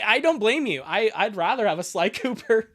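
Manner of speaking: ordinary talk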